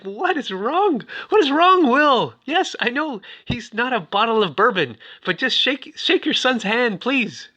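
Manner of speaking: high-pitched exasperated appeal; exasperated pleading. Retold or imitated. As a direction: plead in mock despair with a stubborn father. Exasperated plea to standoffish Will.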